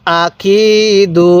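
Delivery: আকিদু very slow, কি held long and level; one-alif elongation. Shown as a demonstration. Teacher showing how to read, drawn-out আকিদু. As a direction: chant আকিদু, stretching the middle কি. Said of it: "এরকমভাবে শিখব".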